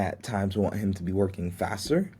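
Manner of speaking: ordinary talk, nothing marked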